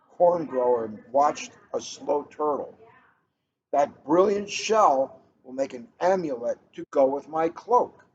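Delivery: gentle, even read-aloud pace; storybook read-aloud. A narrator's reading. Librarian reading a picture book to the room, storytelling voice.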